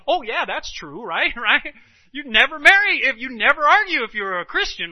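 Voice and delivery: loud, high-pitched, laughing fake assent; mock agreement. Mockingly overplayed agreement, laughing.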